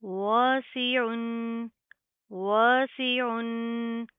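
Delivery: वासिउन stretched very slowly with a clear ain; separately drawn-out recitation; separate word demo. Shown as a demonstration. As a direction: pronounce वासिउन alone, slowly. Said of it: "separate भी पढ़ के दिखाती हूँ".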